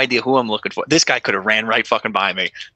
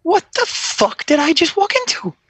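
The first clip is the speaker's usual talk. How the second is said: stunned disbelief. Retold or imitated. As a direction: let the shock pour out. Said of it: "And I'm like".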